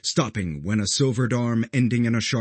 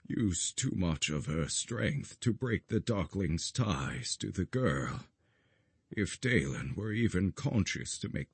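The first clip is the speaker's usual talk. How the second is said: put-on grieving old guardian; 7 dB quieter than usual. A narrator's reading. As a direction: speak softly, heavy with grief. Olmec explains in grief.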